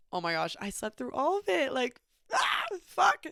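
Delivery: high, exclaimed oh-no reaction; retold dismayed outburst; mock dismay. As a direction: act out waking up and realizing you missed it. Retells waking up mortified.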